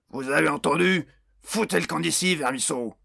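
angry shout at goblins. A narrator's reading. Orc boss yelling at the whimpering goblins, furious shout. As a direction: bark them out of the room like a brute boss.

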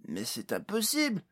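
high-pitched protest in character; incredulous protest. A narrator's reading. Pod refusing to believe in the endless staircase, protesting. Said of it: "répéta Pod".

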